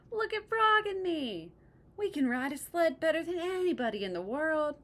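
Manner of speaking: loud, high bragging call; boastful shout. Acted. Toad bragging to the crow from the sled, shouting high. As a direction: crow about it to the bird.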